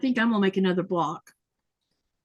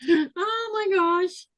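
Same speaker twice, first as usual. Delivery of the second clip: oh my gosh; 8 semitones higher, much slower than usual. Real, not acted. Reacts to own wrong guess.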